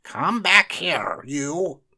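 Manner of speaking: loud, slow, high yell; magician crying out. A narrator's reading. Magician chasing his rabbit, yelling. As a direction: yell after the runaway rabbit. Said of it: "cried Professor Hinkle".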